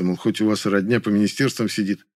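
usual speaking voice

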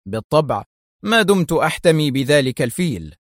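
usual speaking voice

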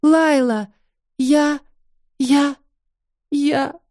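sobbing on the phone. Acted. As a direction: choke out her name between sobs. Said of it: "Я рыдала так сильно".

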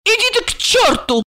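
high-pitched tipsy woman's anger; cross outburst. A narrator's reading. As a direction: send him packing in a high, cross voice. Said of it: "рассердилась Ольга".

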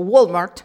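imitating an American "Walmart"; "Walmart" with English vowels; American pronunciation. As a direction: say "Walmart" as a native English speaker would, loud. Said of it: "cuando habla y dice cosas como".

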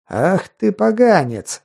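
high, slow gurgling monster voice; gurgling fish man croak. Acted. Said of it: "жуткий булькающий голос".

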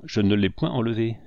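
ordinary delivery

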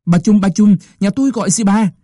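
loud, high calling of the midwife; flustered calling. A narrator's reading. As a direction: call out in a fluster. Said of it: "cuống quýt nhòm dậy gọi".

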